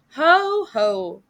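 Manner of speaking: loud gleeful ho ho; gleeful crowing over his own cleverness; elated cry. A narrator's reading. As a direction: crow with delight at yourself. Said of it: "he cried, in elation and self-admiration".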